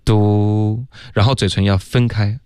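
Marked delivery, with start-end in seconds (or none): 0.0-0.8 嘟 held long as "doo" with pushed-out lips; pouted lips. Shown as a demonstration. Teacher showing how door begins, sustained pouted sound. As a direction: pout and sustain 嘟. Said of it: "是嘟起来的".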